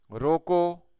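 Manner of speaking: "रोको" loud and high, set off by a pause; stress on रोको. Shown as a demonstration. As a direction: punch "रोको" as a separate command. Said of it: "के बाद अल्प विराम लगाने से".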